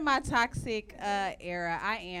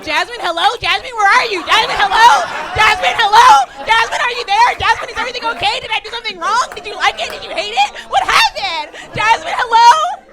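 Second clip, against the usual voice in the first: much higher, much louder, frantic repeated calls, cracking into a crying shout at the end; panicking guy begging for a reply, pitch way up; desperate texting man; 11 semitones higher, 18 dB louder than usual. Retold or imitated. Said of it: "They be like".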